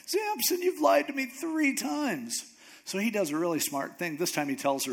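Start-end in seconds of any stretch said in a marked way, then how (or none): 0.0-2.4 very high, slow wail; hammed-up distraught complaint; mock upset. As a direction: whine it out as a wronged girlfriend. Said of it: "she's just so upset".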